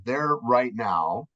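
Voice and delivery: ordinary talk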